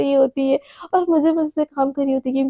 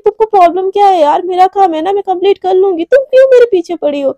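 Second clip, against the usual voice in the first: louder and higher, fed up and lashing out; annoyed intern snapping at a pestering senior, louder and higher; furious; 4 semitones higher, 6 dB louder than usual. A narrator's reading. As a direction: shout her off, higher and louder. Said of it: "Radhika का दिमाग खराब हो जाता है".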